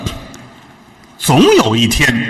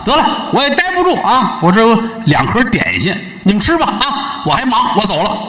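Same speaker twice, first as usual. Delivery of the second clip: put-on lightly raised, breezy young visitor; breezy young man; 9 semitones higher than usual. Visitor saying goodbye to the couple, breezy and airy. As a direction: brush them off and leave, breezy.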